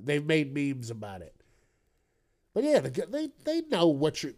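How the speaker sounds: ordinary talk, nothing marked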